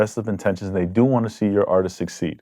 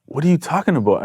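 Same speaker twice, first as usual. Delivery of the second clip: higher-pitched, defensive question; retold bewildered reply; 7 semitones higher than usual. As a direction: re-enact a baffled comeback.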